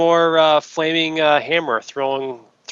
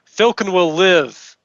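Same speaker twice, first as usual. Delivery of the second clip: heroic vow; 7 semitones higher, 5 dB louder than usual. Acted. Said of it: "And say".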